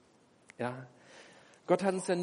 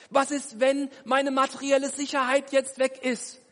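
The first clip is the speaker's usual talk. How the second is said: higher, louder, rising panic; anxious worried thoughts; 8 semitones higher, 8 dB louder than usual. Acted. Believer in the storm voicing fearful thoughts, escalating panic. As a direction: spiral into fear as the waves grow. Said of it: "kommen dir folgende Gedanken".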